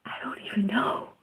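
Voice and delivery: whispered, fast, bewildered aside. Real, not acted. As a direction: breathe it out under your breath.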